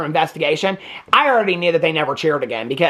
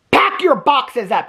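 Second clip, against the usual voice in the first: higher, louder, slow mock command; stern order; 6 semitones higher, 3 dB louder, slower than usual. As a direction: bark it at your daughter. Principal mom ordering daughter out.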